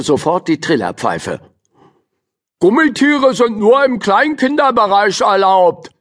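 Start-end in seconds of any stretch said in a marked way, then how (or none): 2.6-5.9 high, loud officious lifeguard call. A narrator's reading. Lifeguard calling a rule to Jo, high and officious. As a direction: bark the pool rule across the water. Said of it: "rief er Jo zu".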